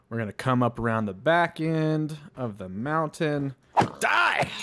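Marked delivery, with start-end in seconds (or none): 4.0-4.5 sudden high-pitched yelp of attack; high, sudden attack cry; battle cry. Real, not acted. Attacking something in the game.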